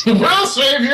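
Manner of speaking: high, loud villager yell; hammed-up high heroic call; rescuer's shout. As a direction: shout up to the captive. Villagers answer the captive.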